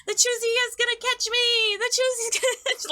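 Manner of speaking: put-on high child voice, playful taunt; giggling kid. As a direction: taunt in a high, gleeful kid's voice. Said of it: "giggling and wearing the mask".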